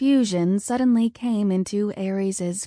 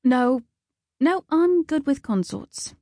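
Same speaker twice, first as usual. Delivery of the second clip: higher, hasty objection; quick interjection; 6 semitones higher than usual. A narrator's reading. Loki hastily settles for consorts, quick and higher. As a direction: jump in quickly to agree to it. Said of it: "Loki said quickly".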